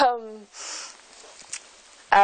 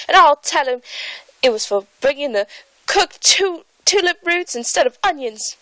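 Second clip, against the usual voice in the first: tattling gardener; 6 semitones higher, 3 dB louder than usual. Acted. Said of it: "said Five".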